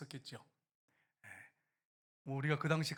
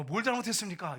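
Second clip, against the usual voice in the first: raised, louder objection; retold protest; 5 semitones higher, 6 dB louder than usual. Soldier asking what he did wrong, raised protest. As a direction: protest it back at a superior.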